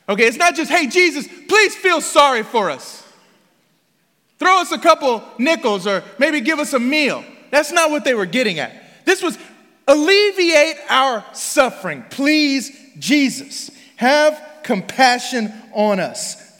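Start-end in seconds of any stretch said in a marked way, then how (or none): 0.7-7.2 higher, louder mock plea; acted begging. Voicing beggars, contrasted with real plea. 9.9-16.6 high, drawn-out cry for compassion; loud desperate plea. Acted. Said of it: "they screamed that out in a loud voice".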